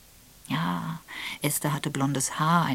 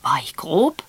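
surprised; 8 dB louder than usual. Acted. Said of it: "fragte Mister Raphael erstaunt".

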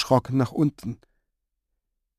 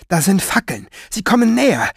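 out of breath, urgent and higher; breathless; 7 semitones higher than usual. Acted. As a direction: gasp it out, winded. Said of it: "stieß er atemlos hervor". (atemlos hervorgestoßen)

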